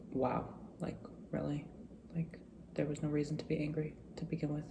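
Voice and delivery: retold hushed self-reproach, under the breath; quiet disbelief. Speaker looking back at the day's anger, quietly incredulous.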